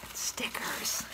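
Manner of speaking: hushed excited whisper. Real, not acted. Finds stickers in the package, whispering. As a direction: breathe it like a secret treasure.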